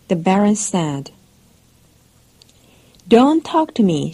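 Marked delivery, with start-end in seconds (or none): 3.1-4.1 higher, a touch louder mock shout; narrated shout. A narrator's reading. Stepmother cutting off her husband, a mild raised shout. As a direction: snap it up high without full force. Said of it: "she shouted".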